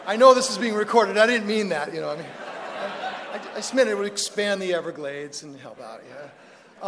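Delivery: loud, laughing backpedal; laughing apology. Real, not acted. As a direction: take it back while still laughing. Backpedalling after joke, loud laughing.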